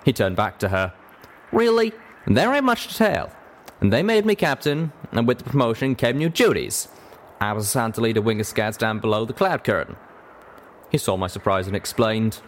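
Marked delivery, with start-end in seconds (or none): 1.5-10.0 higher-pitched folksy Western character; drawling cowpony. Acted. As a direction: tell your story as a plain-spoken cowpony.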